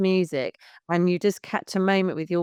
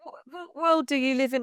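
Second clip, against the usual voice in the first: incredulous; 6 semitones higher than usual. Real, not acted. Mocking the breastfeeding worry.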